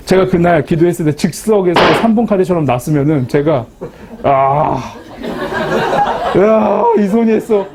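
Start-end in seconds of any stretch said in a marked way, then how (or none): 4.2-7.7 slow, higher, laughing brag about her healing hand; mock boast. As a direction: show off the miracle hand, half joking. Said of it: "이렇게 된 거예요". (장난스럽게 거들먹거리는 자랑)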